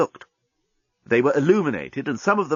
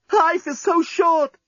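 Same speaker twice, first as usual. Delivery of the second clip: much higher, slow yearning; slow passionate sigh; slower than usual. Acted. Lucy to her lover in the final seconds, high and slow. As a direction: draw it out, breathless with love.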